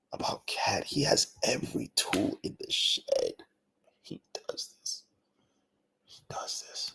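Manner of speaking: hushed, breathy whisper of disbelief. Real, not acted. Host fuming about Cat, in a whisper.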